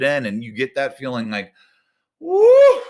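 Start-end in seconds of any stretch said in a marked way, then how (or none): 2.2-2.8 high, loud celebratory whoop; joyful whoop. Retold or imitated. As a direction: let out a big woo of relief. Relief after finishing paper.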